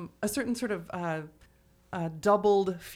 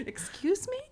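mock disbelief; 11 semitones higher than usual. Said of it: "And I'm like".

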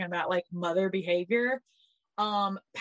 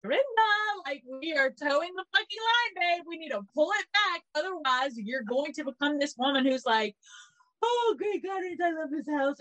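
mock pep talk to Dorinda; 6 semitones higher than usual. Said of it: "I was like".

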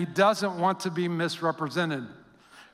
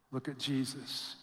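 much softer, hushed answer; 6 semitones lower, 12 dB quieter than usual. Acted. Preacher answering his own question, hushed. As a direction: bring it down to a hush. Sermon refrain after question.

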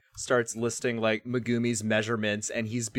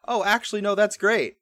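higher, louder cheerful acceptance; naive delight; 9 semitones higher, 7 dB louder than usual. Retold or imitated. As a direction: gladly swallow the excuse. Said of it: "Wataru takes that as".

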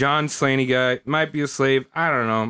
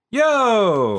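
casual greeting; 7 semitones higher than usual. Retold or imitated. Squanto greeting the Londoner.

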